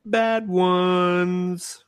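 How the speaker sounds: high, sustained drawn-out words; mock-ominous announcement. Real, not acted. Host moves on to the worst picks, high drawn-out announcement. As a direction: hold each word out up high.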